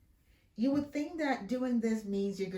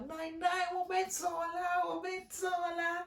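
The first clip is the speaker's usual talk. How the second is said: put-on high, singsong child tugging grandma; pitched-up little boy pleading to leave; high child's singsong voice; imitating a small child; 11 semitones higher than usual. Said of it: "he held the grandma".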